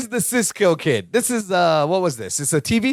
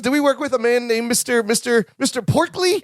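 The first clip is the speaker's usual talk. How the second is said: higher, excited mock Larry; imitating Larry remembering; 6 semitones higher than usual. Retelling the episode, host voices Larry remembering, higher. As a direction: reach up high as memories come back. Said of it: "He goes".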